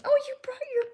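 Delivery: high sing-song pet voice. Real, not acted. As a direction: coo at the puppy. Talking to her dog.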